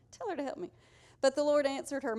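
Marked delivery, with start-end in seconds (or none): 0.1-0.7 high pitched complaining sister, hammed-up; mock whiny Martha. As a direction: squeak it out like an indignant sister. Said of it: "Can you imagine telling Jesus that?".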